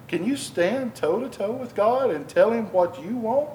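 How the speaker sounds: normal talking voice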